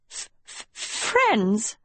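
stuttered disbelief, catching on the first sound; breathy false starts before the word bursts out; sputtering. A narrator's reading. Agatha balking at Sophie's offer of friendship, sputtering. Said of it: "Agatha sputtered".

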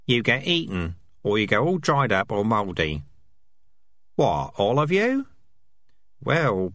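squeaky rat chatter. Acted. Rats voiced in dialogue.

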